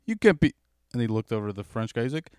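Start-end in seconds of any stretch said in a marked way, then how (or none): 0.0-0.5 high, loud mock outrage in disbelief; exasperated Italian chef. Mocking the Italian chef's outraged protest on the yacht, high and loud. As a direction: squeal it like an indignant Italian chef. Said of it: "The Italian food was like".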